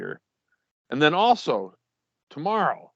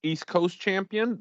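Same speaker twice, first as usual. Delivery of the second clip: normal talking voice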